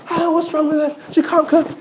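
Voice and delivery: high, whining voice; complaining son.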